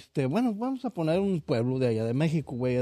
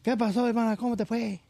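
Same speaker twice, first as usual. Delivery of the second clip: put-on repeated high sing-song Yucatecan accent; high Yucatecan sister asking again; 8 semitones higher, faster than usual. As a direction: ask it again like a chatty Yucatecan woman. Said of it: "Ya ves cómo hablan los yucatecos".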